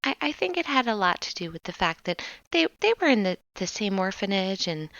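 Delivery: soft hesitant woman. Acted. GM plays Bitsy, hesitant answer.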